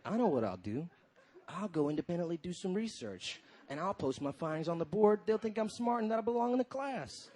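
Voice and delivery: soft, low plotting aside; scheming to himself. Acted. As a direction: think it aloud quietly, with mock seriousness. Comedian acts out his private scheming.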